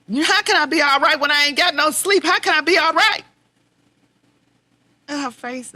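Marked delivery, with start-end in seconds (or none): 0.1-3.2 loud, high, rising questions; demanding an answer, exhausted; frustrated pleading. Retold or imitated. Arguing with her mother.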